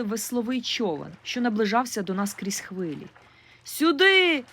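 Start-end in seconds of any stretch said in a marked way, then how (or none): 3.6-4.4 high loud call across the water; shouting for help from the lake. A narrator's reading. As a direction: yell out to the boat for rescue. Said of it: "крикнула я".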